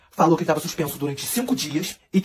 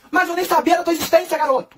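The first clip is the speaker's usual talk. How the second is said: mock yell; 9 semitones higher than usual. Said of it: "o Thales gritou assim".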